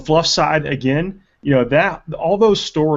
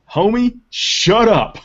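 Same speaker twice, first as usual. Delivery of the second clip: dismissive Lion; 9 semitones higher, 4 dB louder, much slower than usual. Retold or imitated. Lion dismisses Wolf Lord challenger.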